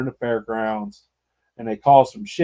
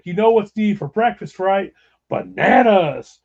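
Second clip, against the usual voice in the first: teasing; 6 semitones higher, 4 dB louder than usual. Retold or imitated. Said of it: "I'm like".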